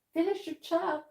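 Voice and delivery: high squeaky mock voice for a friend; lightly voiced cheer for Shannon; high pretend voice. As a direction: chirp it like a cartoon cheerleader. High put-on voice to viewer.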